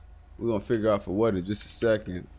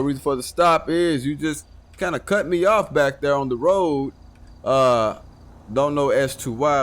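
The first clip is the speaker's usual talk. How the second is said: loud, formal patrol-officer voice; trooper greeting; 3 semitones higher, 4 dB louder than usual. Acted. Trooper to the stopped driver, loud and formal. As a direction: address the driver as a state trooper.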